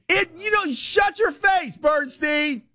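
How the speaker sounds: shout. Real, not acted. Host heckled mid-read.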